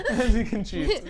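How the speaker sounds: helpless high, slow laughing repeat. Real, not acted. Host repeats guest's cheating joke, laughing. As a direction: barely get it out for laughing.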